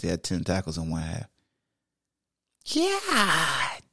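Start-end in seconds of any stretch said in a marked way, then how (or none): 2.7-3.8 high, drawn-out "God dang"; impressed disbelief; amazed exclamation. Real, not acted. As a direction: let it out slowly in awe. Amazed at tackle count.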